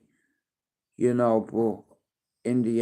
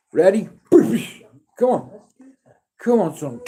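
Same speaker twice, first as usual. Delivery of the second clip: high, louder rising ready; eager rising call to the little boxer; excited coaching call; 6 semitones higher, 5 dB louder than usual. Real, not acted. Dad coaching baby to box.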